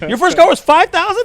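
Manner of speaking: loud, high-pitched amused disbelief; incredulous question. Real, not acted. Teasing a friend about his first car's price, incredulous.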